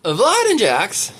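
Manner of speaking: slow, swooping attempt at an impression; Leslie. Reader retrying the impression, tentative put-on voice. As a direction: draw the names out in a put-on voice. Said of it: "what my voice sounds like when I pretend to be".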